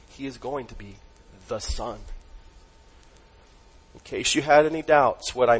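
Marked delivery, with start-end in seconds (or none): 0.1-2.0 "Son" set apart after a pause, hushed and weighty; stress on Son. Shown as a demonstration. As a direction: underline "the Son" with a pause before it, soft and low. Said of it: "It is being emphasized here".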